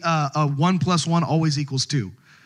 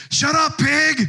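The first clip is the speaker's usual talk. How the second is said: heckler's jeer; 10 semitones higher, 4 dB louder, slower than usual. Retold or imitated. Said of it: "this one voice way up at the top said".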